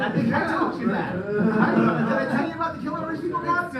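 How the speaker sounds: amused aside to the crowd; laughing aside. Real, not acted. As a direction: ask the crowd while laughing.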